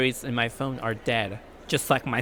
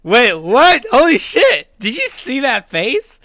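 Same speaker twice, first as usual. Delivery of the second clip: loud, higher startled outburst; real shock; 9 semitones higher, 11 dB louder, slower than usual. Real, not acted. Streamer reacting to a creepy face, loud shocked outburst. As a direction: blurt it out caught off guard.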